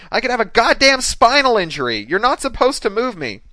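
very high, loud outraged yelling; hollering. A narrator's reading. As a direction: holler in protest as an injured woman. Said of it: "she was hollering".

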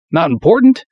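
high, loud disbelief; "Not important?" asked incredulous, pitch jumping up. A narrator's reading. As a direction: toss the words back in disbelief.

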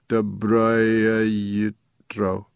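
slow, muffled broken robot. A narrator's reading. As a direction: speak through a bowl of soup. Antique robot bear speaks.

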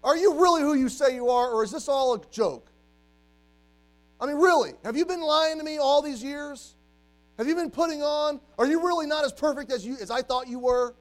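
loud, high pleading challenge to Jesus; John the Baptist confronting. Retold or imitated. John the Baptist to Jesus, a loud pleading challenge. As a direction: demand answers at full voice. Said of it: "John the Baptist could go to Jesus and say".

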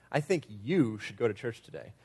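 "you" lifted and held, the rest dropping; stress on YOU. Shown as a demonstration. Preacher showing the emphasis game, stress on "you". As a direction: point at the listener with the word "you". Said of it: "change the emphasis of the words".

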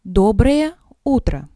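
usual speaking voice

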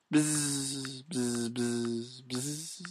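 soft buzzing like a bee. Pretend. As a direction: buzz out bee names, fading. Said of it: "what last names would sound like in the bee worlds".

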